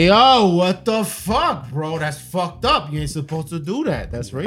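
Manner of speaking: high mock outrage, then dropping off; stretched-out mock disbelief; offended onlooker. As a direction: imitate someone scandalized by a joke. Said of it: "They be like".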